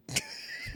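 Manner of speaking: sudden high burst of laughter. Real, not acted. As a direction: laugh out in disbelief. Friend found out the kid.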